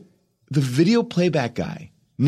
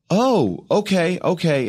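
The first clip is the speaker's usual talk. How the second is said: higher-pitched, polite oh-okay; taken-aback acceptance; 6 semitones higher, slower than usual. Retold or imitated. Actor hears he is being recast, taken-aback acceptance. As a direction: take bad news with a high, agreeable voice.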